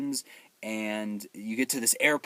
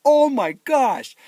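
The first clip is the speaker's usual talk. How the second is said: loud, high, slow-drawn amazement; astonished exclamation; 14 semitones higher, 12 dB louder, much slower than usual. Retold or imitated. Viewer watching a building demolished, astonished shout. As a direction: draw it out in loud awe.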